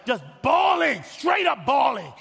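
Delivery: exaggerated outburst. Preacher describes weeping at the TV, hammed-up shout. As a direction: belt it out, stretching the words.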